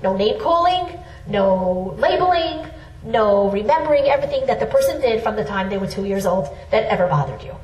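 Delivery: ordinary talk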